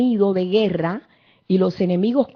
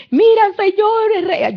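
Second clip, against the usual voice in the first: high, slow crying plea to the king; a desperate mother begging through tears; weeping woman; 11 semitones higher, 5 dB louder, slower than usual. Acted. Preacher voicing the weeping mother before the king, high crying plea. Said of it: "vino una mujer llorando".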